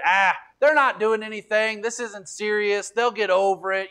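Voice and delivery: high, loud dismissive scoff; jeering enemy, loud and high; mocking scoff. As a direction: brush them off with scorn. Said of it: "they're making fun of 'em".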